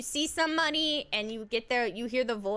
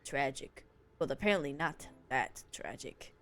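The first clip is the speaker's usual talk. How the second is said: low narrator voice; 10 semitones lower, 5 dB quieter than usual. Acted. Reads game text in low voice.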